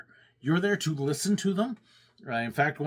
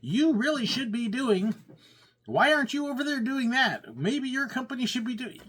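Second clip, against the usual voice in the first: higher, pushy advice-giver voice; mock a know-it-all churchgoer telling the pastor what to do; raised-pitch imitation of an unsolicited advisor; nagging congregant; 8 semitones higher than usual. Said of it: "the people are like".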